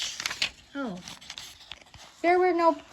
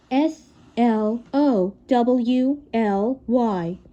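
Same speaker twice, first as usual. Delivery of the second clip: letters said one by one, very slow; slow spelled-out letters; spelled slowly; much slower than usual. Shown as a demonstration. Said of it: "spells slowly".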